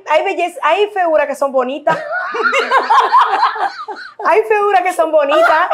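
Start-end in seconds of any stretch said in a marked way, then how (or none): 2.0-4.2 high burst of laughter. Real, not acted. Big laugh at joke. 4.2-5.7 laughing. Real, not acted. Repeating while laughing.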